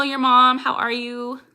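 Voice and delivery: usual speaking voice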